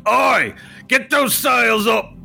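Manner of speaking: high, drawn-out shouted order; verbal lashing. Acted. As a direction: bellow at a slacking crew. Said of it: "Watch this".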